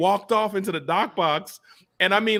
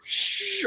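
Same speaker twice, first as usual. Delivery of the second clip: soft, breathy shushing sound; 6 dB quieter than usual. Retold or imitated. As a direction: hush someone gently with a long "shh". Acts out the shush.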